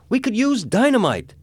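high, loud frantic shout; shouted suggestion. A narrator's reading. Someone in the crowd proposes blasting the safe, shouting. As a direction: yell it across the room, pitch leaping. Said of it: "Someone shouted".

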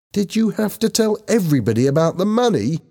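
high-pitched, accusing question; indignant demand; angry. A narrator's reading. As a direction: confront the friends, annoyed. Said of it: "she asked angrily".

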